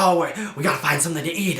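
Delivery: quick, mock frantic vulture voice. Reviewer imitating the hungry pterodactyl vultures, fast and mock frantic. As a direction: rattle it off like a hungry scavenger bird. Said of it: "and they're like".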